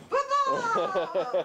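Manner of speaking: laughing, excited shout of the word; excited cheer. Real, not acted. Cheering dog's toy, laughing.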